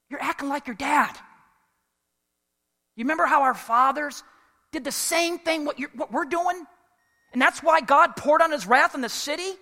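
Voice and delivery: a hint of a scolding Nehemiah. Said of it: "He's like".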